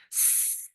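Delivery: long unvoiced snake hiss; drawn-out s like a snake. Shown as a demonstration. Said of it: "snake breath?".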